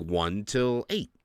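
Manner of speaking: normal talking voice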